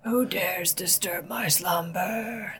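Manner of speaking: slow spooky whisper of a waking house; grinding stone statue. Retold or imitated. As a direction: whisper it slowly like an ancient thing stirring. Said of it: "That's what I ... immediately imagined".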